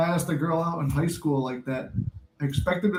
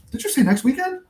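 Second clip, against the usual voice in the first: hopeful surprise; 10 semitones higher than usual. Retold or imitated. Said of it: "I'm like".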